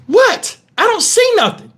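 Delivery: high, dismissive wife voice; mock wife. Wife brushing off her stung husband's face, high and dismissive. Wife's unbothered reply.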